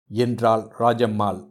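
usual speaking voice

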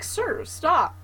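high pleading voice; mock pleading. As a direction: beg them to stop, half-joking. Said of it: "And I'm like".

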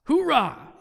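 loud, high Marine battle cry; Marine hoorah. Shown as a demonstration. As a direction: shout it like a drill Marine. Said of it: "like the Marine thing".

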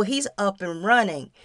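usual speaking voice

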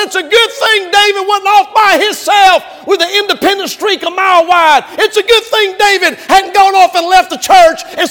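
high, loud fiery preacher shout; preaching at full force. Real, not acted. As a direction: thunder it at the congregation.

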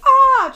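loud high alarmed ah; panicked cry. Retold or imitated. Father Memphis crying out, losing his chick. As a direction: yelp in sudden panic. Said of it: "Memphis is like".

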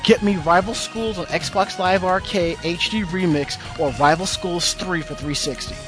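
ordinary talk, nothing marked

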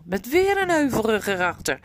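ordinary delivery

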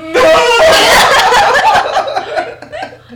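shrieking laughter; loud high laugh. Real, not acted. Big laugh.

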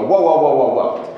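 loud hammed-up whoa, backing off; playful Hoosier cutting off an insult, loud; mock protest. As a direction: shout them down in mock alarm. Hoosier protesting the cousins jab.

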